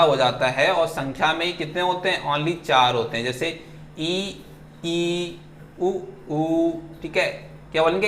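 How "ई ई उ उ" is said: ई and उ held, softer; vowel demo. Shown as a demonstration. Teacher models closed vowels ई, उ for students. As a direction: show the narrow vowels ई, उ. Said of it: "मेरे ये जो lips हैं इसको आप देख रहे हैं".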